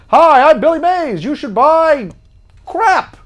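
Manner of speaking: high, loud infomercial shout; Billy Mays pitchman voice. Retold or imitated. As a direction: sell it like a TV pitchman. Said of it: "Billy Mays, the infomercial guy".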